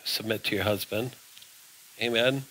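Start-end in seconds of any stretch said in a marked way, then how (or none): 0.0-1.1 low and much softer, a gentle insistence; quiet Holy Spirit voice. Retold or imitated. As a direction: murmur it like a patient inner voice. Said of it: "the Holy Spirit's saying".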